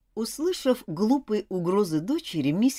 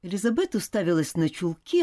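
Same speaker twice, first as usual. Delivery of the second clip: usual speaking voice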